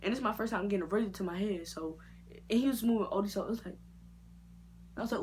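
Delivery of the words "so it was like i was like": ordinary talk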